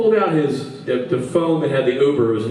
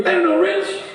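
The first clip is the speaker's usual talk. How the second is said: high, helpless monotone; drunk Rob. Retold or imitated. Lost friend answering where he is, high helpless drunk voice. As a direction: wail it like a clueless drunk. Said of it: "where are you?".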